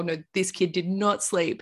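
ordinary talk, nothing marked

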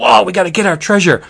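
lightly acted treasure-hunter's excitement; mock excitement. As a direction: rush it out, eager for loot. Orcs rushing to the treasure.

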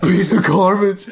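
loud laughing outburst. Real, not acted. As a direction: crack up while saying it.

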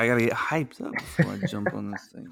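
drowsy, half-asleep mumble; sleepy voice. Real, not acted. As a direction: talk like you just woke up. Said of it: "why does your voice sound like you're sleeping".

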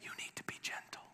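quick unvoiced whisper. Shown as a demonstration. Preacher whispering what God did not say. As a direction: hush it quickly. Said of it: "it wasn't like he was saying".

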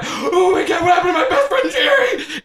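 high-pitched panicked wail for a friend; Brantley, distraught. Acted. Brantley finds his best friend hurt.

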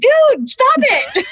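high, loud retold protest; mock exasperated shout. Objecting to auntie's dirty tricks, exasperated shout. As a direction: cry out in laughing disbelief.

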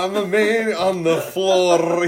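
slow, lightly done mock Scottish; ridiculous accent. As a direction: drawl it in a silly Scottish. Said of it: "the most ridiculous accent".

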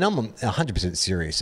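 ordinary talk

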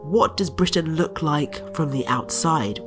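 ordinary delivery

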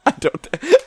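high burst of laughter; laughing too hard to finish. Real, not acted. Cracking up at a friend's mom voice, high laughter.

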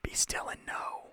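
hushed whisper; reverent whisper. Real, not acted. Quoting scripture in awe.

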